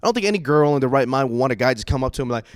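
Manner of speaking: ordinary delivery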